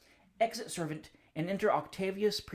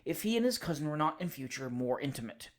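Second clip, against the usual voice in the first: ordinary delivery, nothing marked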